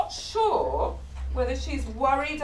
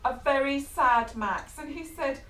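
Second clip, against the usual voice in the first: ordinary delivery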